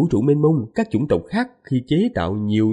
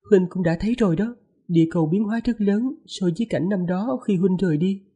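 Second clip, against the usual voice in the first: higher, lightly voiced young wife; man doing a woman's voice; 7 semitones higher than usual. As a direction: speak up high as the gentle wife. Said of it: "Lát giả giọng nữ".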